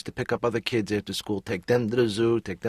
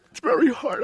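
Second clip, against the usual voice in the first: hammed-up crying; 7 dB louder than usual. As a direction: voice jumps much higher and louder as he breaks into tears. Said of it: "I promised myself I wasn't gonna cry today".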